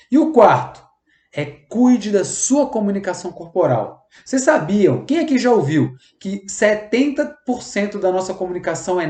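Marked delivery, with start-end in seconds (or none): none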